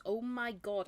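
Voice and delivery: usual speaking voice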